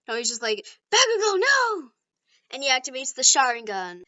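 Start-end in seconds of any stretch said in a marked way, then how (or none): 0.9-1.9 high, loud, drawn-out shout; alarmed cry. Acted. Deku seeing Bakugo thrown, alarmed cry. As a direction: call out to stop a disaster. Acted cry to falling friend.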